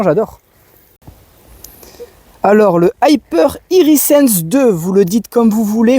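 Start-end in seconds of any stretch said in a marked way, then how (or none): 2.4-4.7 "Hyper Irisense deux" said slowly and higher, with a French accent; carefully voicing "Hyper Irisense deux" in French-accented English; French-accented brand name. Real, not acted. Presenting the sprinkler to viewers, French-accented brand name. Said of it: "vous ne critiquez pas mon accent".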